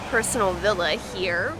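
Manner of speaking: ordinary talk, nothing marked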